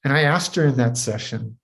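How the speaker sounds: ordinary talk, nothing marked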